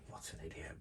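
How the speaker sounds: imitating a viewer, whispered not screamed; mock outrage under the breath. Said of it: "probably screaming at me now".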